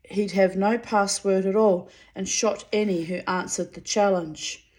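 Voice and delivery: plain reading voice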